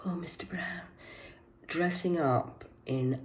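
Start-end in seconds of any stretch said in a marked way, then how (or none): 0.0-0.8 whispered teasing name; mock sultry whisper. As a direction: breathe the name slyly.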